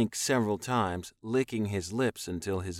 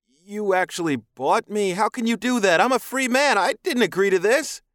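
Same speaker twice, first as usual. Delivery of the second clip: very high, loud, indignant protest; outraged captive human; 12 semitones higher, 9 dB louder than usual. A narrator's reading. Captive man protesting his sale, outraged. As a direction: cry out in disbelief, pitched way up.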